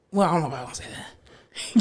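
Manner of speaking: soft, whispered second thought; hushed aside. Real, not acted. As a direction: drop to a whisper as you back off. Hesitant aside, backing off a statement.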